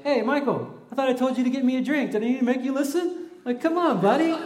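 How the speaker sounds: high, fast, laughing mock jab; family teasing. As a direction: roast him with a grin. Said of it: "I get roasted by my family".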